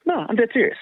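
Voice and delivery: high, insistent voice of the coach; coach insisting. Retold or imitated. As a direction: mimic the coach swearing it's true. Coach insisting girl is faster.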